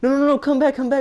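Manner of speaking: high, loud pleading call after someone. Retold or imitated. Creep calling the kid back, high and loud begging. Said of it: "He's like".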